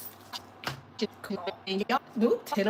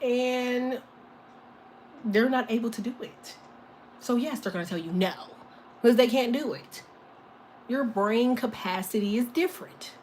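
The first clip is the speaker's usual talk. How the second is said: ordinary talk, nothing marked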